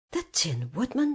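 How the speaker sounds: ordinary delivery, nothing marked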